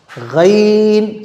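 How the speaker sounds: "गैन" as throaty ghayn, drawn out in two sounds; pronunciation demo. Shown as a demonstration. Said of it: "गैन नहीं". ("गैन" हलक से खींचकर)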